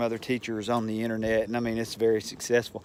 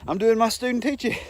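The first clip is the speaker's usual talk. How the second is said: higher put-on voice of a baffled student teacher; bewildered young self; 10 semitones higher, faster than usual. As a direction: play your younger self, lost and pleading. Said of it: "And I was like".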